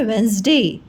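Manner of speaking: वेडनेसडे said as WENZ-day, first D silent; standard pronunciation. Shown as a demonstration. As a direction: run वेडनेसडे into two syllables, wens-day. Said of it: "इसका pronunciation है".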